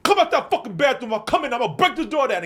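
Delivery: high, loud yelling through a door; bully's threat. Retold or imitated. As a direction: yell threats as the raging bully.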